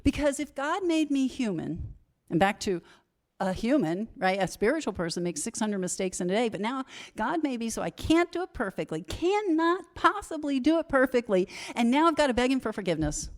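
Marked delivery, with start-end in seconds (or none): none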